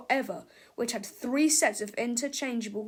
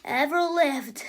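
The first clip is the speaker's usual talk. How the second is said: pompous boast; 4 semitones higher, 6 dB louder, slower than usual. Acted. Villain boast.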